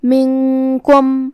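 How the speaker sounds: stretched, level chant of منکم with a long hum on the n; drawn-out recitation. Shown as a demonstration. Teacher demonstrating a Quran word, slow tajweed recitation. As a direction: recite منکم very slowly, holding the nasal n.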